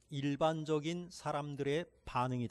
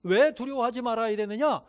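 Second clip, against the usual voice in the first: normal talking voice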